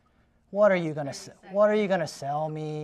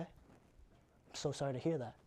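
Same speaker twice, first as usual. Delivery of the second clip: slow, quiet sympathy; soft condolence; 3 semitones lower, 11 dB quieter, slower than usual. Acted. Agent consoling a seller whose mother died, soft and slow. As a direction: say it gently and slowly.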